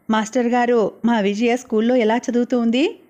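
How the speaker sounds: ordinary delivery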